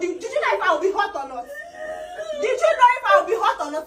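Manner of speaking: usual speaking voice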